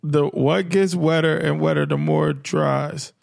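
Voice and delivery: slow repeat. Real, not acted. Repeats riddle slowly to think.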